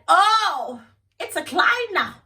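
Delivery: high, loud scoffing at his excuse; sarcastic disbelief. Retold or imitated. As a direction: scoff at the boyfriend's client story.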